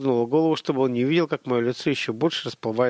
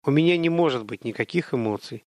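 ordinary delivery

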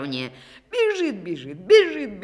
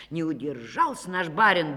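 usual speaking voice

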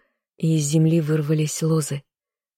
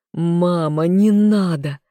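anguished scream; 4 dB louder, slower than usual. A narrator's reading. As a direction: tear the throat calling her. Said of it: "надрывно закричал я". (надрывный крик, громче и выше)

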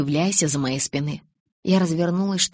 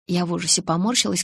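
ordinary delivery, nothing marked

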